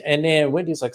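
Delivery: ordinary talk, nothing marked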